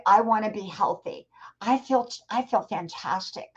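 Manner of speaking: ordinary talk, nothing marked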